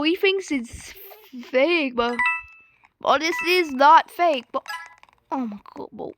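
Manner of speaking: usual speaking voice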